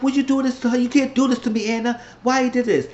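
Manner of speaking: high, heated mock complaint; high-pitched retold outrage; imitated angry protest. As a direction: act out someone betrayed and getting heated. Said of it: "he was getting angry".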